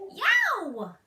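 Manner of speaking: high, loud excited yelp; squeal. Retold or imitated. Liesel after the kiss, squealing yelp. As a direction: let out a sudden squeal.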